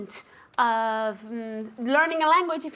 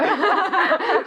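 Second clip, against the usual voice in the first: louder, higher burst of laughter; 5 semitones higher, 5 dB louder than usual. Real, not acted. Teacher joking about what viewers know, real laugh. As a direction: laugh out loud, amused. Teacher amused during lesson.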